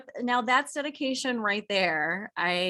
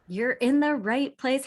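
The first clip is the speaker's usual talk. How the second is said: ordinary talk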